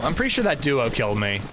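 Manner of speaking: normal talking voice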